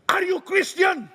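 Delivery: high shouted challenge. Acted. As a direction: hurl the question at yourself. Preacher shouts challenge to self. (teriakan menantang)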